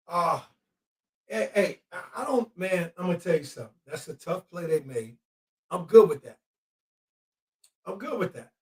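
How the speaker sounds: quietly resigned; deflated mutter. Real, not acted. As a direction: concede it under your breath. Dropped voice after opponent's play.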